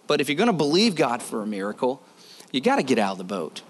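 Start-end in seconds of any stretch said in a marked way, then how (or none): none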